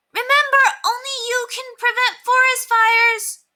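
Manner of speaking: put-on very high, slow, loud child's voice; a five-year-old proudly reciting a slogan; little girl shouting. A narrator's reading. Ramona shouting the slogan to the new lady, very high and loud. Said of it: "Ramona shouted to the lady".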